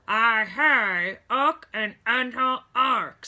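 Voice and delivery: loud, slow, muffled mumble; frustrated gauze-stuffed patient; mouth full of gauze. A narrator's reading. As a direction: shout past the gauze. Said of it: "said Mister Clydesdale".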